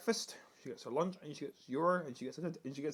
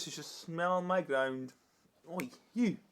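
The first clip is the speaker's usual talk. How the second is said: usual speaking voice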